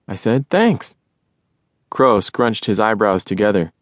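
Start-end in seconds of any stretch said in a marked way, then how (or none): 0.5-1.0 excited. Acted. George thanks Crow brightly.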